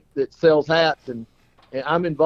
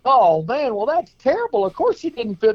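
much higher, exaggerated sympathy; mock-shocked friend; 9 semitones higher than usual. Friend reacting to the clown's dream, mock shocked. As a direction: gasp it out in big sympathy.

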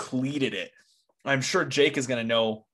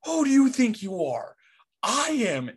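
Pete Weber's taunt; 9 semitones higher than usual. Retold or imitated. Bowler answering the heckling kid after his strike, a high hammed-up shout. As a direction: fling the boast back at the kid, hammed up. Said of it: "turns and looks at the little kid and just goes".

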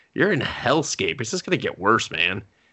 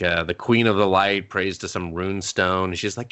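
ordinary talk, nothing marked

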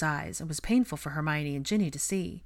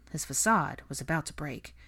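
ordinary delivery, nothing marked

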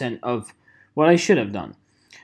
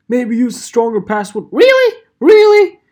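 high, loud incredulous squawk; mock outrage; 8 semitones higher, 11 dB louder than usual. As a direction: jump way up in exasperated disbelief. Scoffing at the help sheet's advice.

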